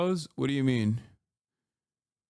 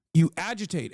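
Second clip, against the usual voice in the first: ordinary talk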